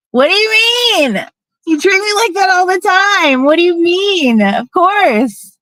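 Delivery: high-pitched, incredulous retort; indignant disbelief. Retold or imitated. Answering ex's apology, indignant high retort. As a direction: throw the excuse back, voice shooting up.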